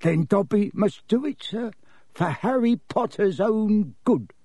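high, regretful elf voice; high-pitched, solemn elf; tragic house-elf. Acted. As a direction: announce it mournfully as a small creature. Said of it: "Dobby gave him a tragic look".

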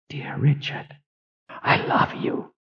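hushed, barely voiced farewell; tender whisper. A narrator's reading. Overwhelmed woman's last thought to Richard, tender whisper. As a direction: breathe it out softly and slowly. Dying heroine's silent farewell to beloved.

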